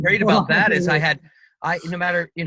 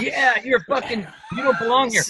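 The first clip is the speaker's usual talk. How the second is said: mocking heckle; 7 semitones higher than usual. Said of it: "go behind Rich Fate and go".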